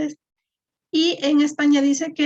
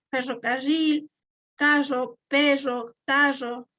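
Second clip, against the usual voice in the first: Cuenca accent, dragged R in "ferrocarril, carro, perro, tarro"; slower than usual. Shown as a demonstration. Teacher showing how Cuenca speakers say "ferrocarril, carro, perro, tarro", dragged-R Cuenca accent. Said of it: "sí se arrastra bastante la R".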